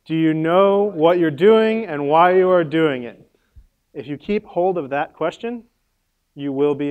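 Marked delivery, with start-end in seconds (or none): 0.0-3.3 slow, loud group recitation; loud, drawn-out motto, word by word; leading a chorus. Real, not acted. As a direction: say it slowly for a crowd to join in. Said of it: "Will you say it with me?".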